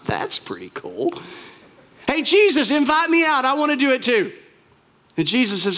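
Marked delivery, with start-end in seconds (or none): 0.1-1.1 low, slow, soft aside; impressed musing. Acted. Peter thinking as he watches Jesus walk on water, low musing. 2.1-4.3 high-pitched, excited plea; eager calling out. Acted. Peter calling from the boat to Jesus, high and eager.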